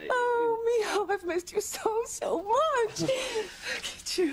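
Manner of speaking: high, teary, overjoyed greeting; tearful joy. Acted. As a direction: cry with happiness at seeing him. Mother reunites with son, crying.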